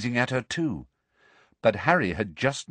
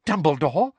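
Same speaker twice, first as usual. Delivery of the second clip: raised, rising eager question; 8 semitones higher, 3 dB louder than usual. A narrator's reading. Professor seizing on the missing headmaster's name, eager. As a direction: pounce on the name. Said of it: "said Umbridge eagerly".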